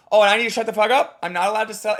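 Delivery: mock indignant. Coach voicing the audience, mildly indignant. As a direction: huff like a cut-off crowd. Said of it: "It's like".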